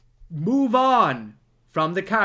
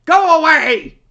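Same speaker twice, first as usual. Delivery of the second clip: much higher, loud shouting mock doorman; doorman yelling; 8 semitones higher, 10 dB louder than usual. Doorman chasing visitors from the door, loud high mock shout. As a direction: bark them off the doorstep. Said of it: "chap pops out of the door and goes".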